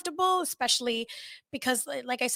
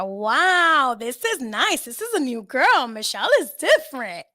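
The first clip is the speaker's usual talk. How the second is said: proud delight; 3 semitones higher, 6 dB louder, slower than usual. Retold or imitated. Proud reflection on new self.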